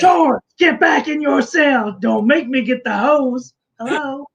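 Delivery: high shouty matron voice; prison guard. Pretend. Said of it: "Or be like Missus Doubtfire".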